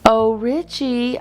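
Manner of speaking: cooing. A narrator's reading. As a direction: coo it sweetly to lure him in. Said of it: "I cooed".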